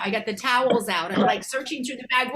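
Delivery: usual speaking voice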